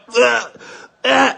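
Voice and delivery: high choking "ugh" noises; imitated choking. Father mimicking his son choking on pizza, imitated choking. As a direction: mimic someone choking in short, high bursts. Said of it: "He starts literally choking".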